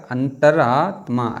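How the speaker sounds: अंतरात्मा drawn out part by part; slow spelling demo. Shown as a demonstration. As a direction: slowly stretch अंतरात्मा, antar-aa-tmaa. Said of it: "ऐसे".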